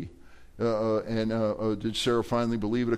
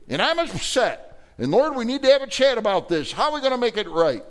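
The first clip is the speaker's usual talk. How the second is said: put-on much higher, louder, old man pleading with God; exasperated Abraham; 12 semitones higher, 6 dB louder than usual. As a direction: complain to the Lord in a high, strained voice. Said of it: "Abraham said".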